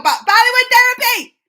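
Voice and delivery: excited. Real, not acted. Excited about Bollywood workouts.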